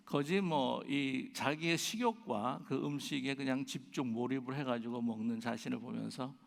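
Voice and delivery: normal talking voice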